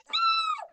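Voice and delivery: high-pitched exaggerated wail; hammed-up crying "Ah!", thin and very high; mock crying wail. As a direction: fake a high, drawn-out sob. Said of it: "I'm crying".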